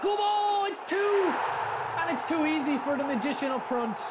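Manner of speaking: high, loud commentator-style yell; goal shout. Real, not acted. Streamer shouting his striker's name after scoring, loud. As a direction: roar the second goal.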